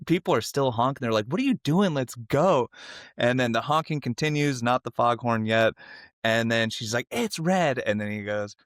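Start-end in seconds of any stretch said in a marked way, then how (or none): 1.3-2.7 high-pitched mock exasperation; impatient drivers. Honking drivers to girl at the red light, mock impatient. 7.1-7.8 upset protest. Retold or imitated. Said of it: "she gets really upset".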